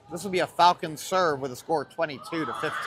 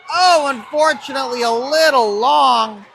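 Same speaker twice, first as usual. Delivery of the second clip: loud, high, stretched-out letdown; dismayed near-miss call; 7 semitones higher, 7 dB louder than usual. Real, not acted. Commentator as the shot goes long, loud dismay. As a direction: cry out, then draw out the last words.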